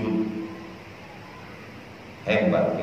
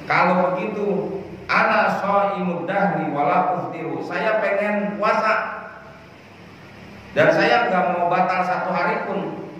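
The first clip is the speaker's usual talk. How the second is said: higher-pitched voice of a resolute companion; retold vow; 7 semitones higher than usual. Companion vowing to fast every day, retold in a higher, resolute voice. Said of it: "yang lain ngomongnya bukan begitu".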